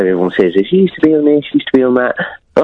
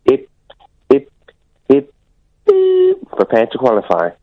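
robotic game announcer; arcade game voice; slower than usual. Retold or imitated. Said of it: "What arcade classic was that?".

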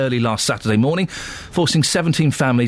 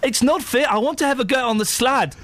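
put-on high, whining South African accent; 9 semitones higher than usual. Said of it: "I can do my South African accent".

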